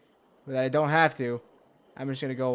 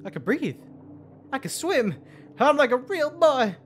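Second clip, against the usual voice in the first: giddy wonder; 11 semitones higher, 4 dB louder than usual. Real, not acted. Amazed at new ability.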